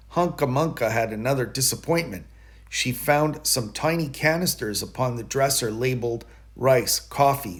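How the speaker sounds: usual speaking voice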